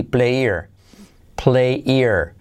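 "player" as play-ear linked; dropped H. Shown as a demonstration. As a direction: say play here without the H, as "player". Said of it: "la H la omitimos".

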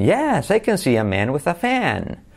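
YES pitched high and drawn out, FAN gliding down; expressive intonation. Shown as a demonstration. Teacher showing the intonation, sing-song and slow. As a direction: swing the tune from high YES to a falling FAN. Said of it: "Entonación".

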